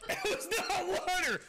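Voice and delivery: high yell between laughs; shocked outcry. Real, not acted. Streamer tricked about a drink, shocked outcry. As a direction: cry out at being tricked. Discovers the drink was alcohol.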